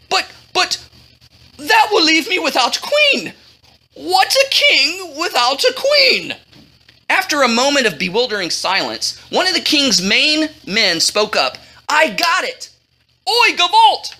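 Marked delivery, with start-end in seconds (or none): 0.1-6.3 high, plaintive king's voice; whiny dismay. A narrator's reading. King realises he will lack a queen, dismayed. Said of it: "the grin turned to a frown". 11.9-14.1 high, exclaimed brainwave; sudden excitement. A narrator's reading. King's man breaks bewildered silence, excited.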